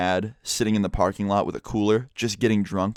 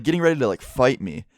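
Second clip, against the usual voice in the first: ordinary talk, nothing marked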